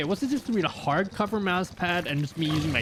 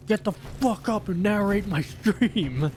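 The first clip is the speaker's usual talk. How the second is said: slow, higher-pitched pretend demand; mock wake-up call; 5 semitones higher, slower than usual. Streamer pretends to wake Optimus to narrate, mock demand. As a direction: rouse a sleeper, playfully bossy and drawn out. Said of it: "go wake him up and be like".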